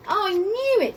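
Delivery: high, bright triumphant shout; whoop of delight. Acted. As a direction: crow it happily. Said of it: "with a whoop of delight".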